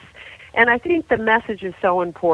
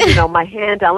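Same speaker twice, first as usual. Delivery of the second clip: usual speaking voice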